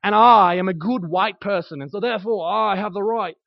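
put-on a light British accent, hinting at a smug slaveholder; lightly voiced British-accented slaveholder, claiming his authority. A preacher mocking a smug slaveholder, in a light British accent. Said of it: "I don't know why I went into British".

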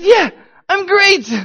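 high, loud, drawn-out giddy answer; goofy grinning cheer. Retold or imitated. Suspect answering police questioner while grinning, giddy. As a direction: blurt it out through a huge silly grin. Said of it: "I can't stop smiling".

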